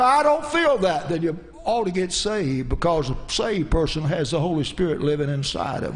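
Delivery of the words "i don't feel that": higher-pitched mock protest; acted objection. Voices an objecting listener.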